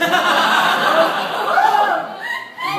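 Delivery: high burst of real laughter; big laugh. Real, not acted. Laughing at her own story. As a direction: crack up at the mother's line.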